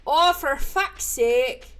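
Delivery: loud, slow Irish-style cursing; attempted Irish accent. Shown as a demonstration. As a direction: curse with a stretched Irish lilt. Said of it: "Is that Irish?".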